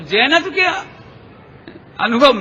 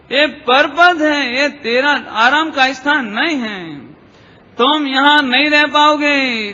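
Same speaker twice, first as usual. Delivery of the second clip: drawn-out exhorting call; slow cry to far-off listeners, rising on key phrases. Acted. Forest-dwelling teachers call out to worldly souls, exhorting. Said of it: "पुकार लगाते हैं".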